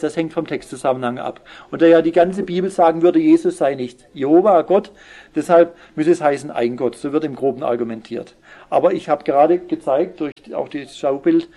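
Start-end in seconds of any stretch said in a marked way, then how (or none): none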